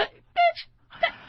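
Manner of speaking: bitch squealed very high and drawn out; squealing bitch. Shown as a demonstration. Comic showing different inflections of bitch, shrieking it high. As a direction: shriek the word bitch in disbelief. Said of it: "here's the different inflections of bitch".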